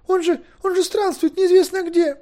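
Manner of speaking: high, loud, flustered protest; flustered high-pitched girl's outcry; bewildered wail. A narrator's reading. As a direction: wail it in utter confusion. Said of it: "завопила совершенно растерявшаяся".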